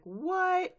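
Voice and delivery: high, startled what; surprised what. Retold or imitated. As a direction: blurt it, caught off guard. Big Mac lands in ditch.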